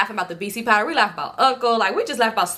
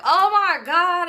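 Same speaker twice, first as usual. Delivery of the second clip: high, slow laughing oh my god; 8 semitones higher, much slower than usual. Retold or imitated. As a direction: drag it out through laughter. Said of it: "I'm just laughing like".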